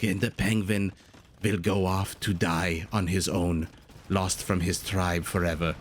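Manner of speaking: a hint of a grave German narrator, dragging each word; Werner Herzog impression. Caster mimicking a director's penguin monologue in a mild German accent. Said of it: "in his very heavy German accent".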